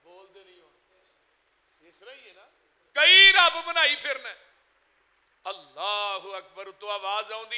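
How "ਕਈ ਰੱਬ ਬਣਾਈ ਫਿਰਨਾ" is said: high, forceful exclamation falling at the end; loud rebuke. Real, not acted. Preacher repeating it to a silent congregation, loud high rebuke.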